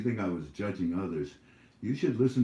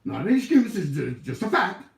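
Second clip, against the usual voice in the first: much higher, louder mocking whine; mocking critic; 10 semitones higher, 7 dB louder than usual. Vlogger still mimicking the smug critic. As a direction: squeak it out smugly.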